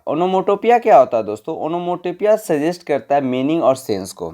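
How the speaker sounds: usual speaking voice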